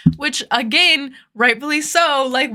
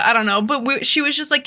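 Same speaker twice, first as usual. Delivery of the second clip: ordinary talk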